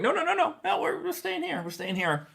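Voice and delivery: very high, quick mock protest; high-pitched playful "no, no, no"; acted-out self-correction. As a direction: talk yourself back in a squeaky-high voice. Acts out correcting himself.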